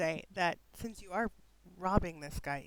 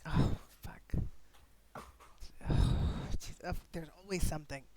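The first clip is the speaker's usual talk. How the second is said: whispered; 2 semitones lower, slower than usual. Acted. Guard startled, whispered aside.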